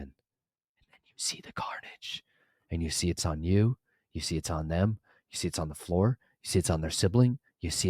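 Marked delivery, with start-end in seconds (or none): 0.0-2.2 slow, hushed horror reveal; dramatic whisper. Real, not acted. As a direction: whisper it slowly, dragging out the dread.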